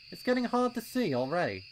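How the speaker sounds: high, loud, impatient colt voice; a keen little colt itching to go; eager young colt. A narrator's reading. Pipsqueak urging his friends at the trail, high and loud.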